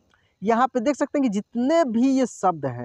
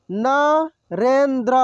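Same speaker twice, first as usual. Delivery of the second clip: Narendra drawn out slowly, syllable by syllable; slow sounding out; much slower than usual. Shown as a demonstration. Teacher slowly sounds out Narendra for students. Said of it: "एक एक मात्रा को अलग अलग करके".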